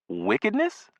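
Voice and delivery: high rising disbelief on "Wickedness?"; "Wickedness?" thrown up high as an alarmed question; startled echo. Acted. Boy echoing the neighbor's warning word, high and alarmed.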